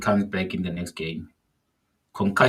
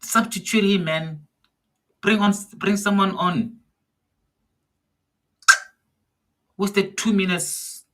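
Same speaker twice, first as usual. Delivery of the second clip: frustrated yelling; 8 semitones higher than usual. Real, not acted. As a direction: yell at the TV to get him off. Fan demanding substitution at TV.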